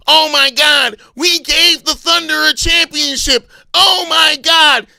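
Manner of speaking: loud, high, stretched-out mock lament; hammed-up complaining wail; mock whining fans. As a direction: mimic fans moaning in outrage. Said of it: "whine, complain, and moan".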